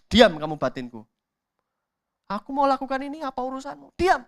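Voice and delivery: loud, angry shout at your own conscience; snapping back. Acted. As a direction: bark it down to silence the inner voice. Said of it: "Kalau kita bentak-bentak".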